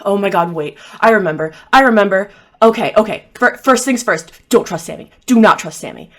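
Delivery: loud, pressing acted warning; insistent, words punched out loudly; urgent warning. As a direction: blurt it out before time runs out. Possessed character regains memory, urgently warns.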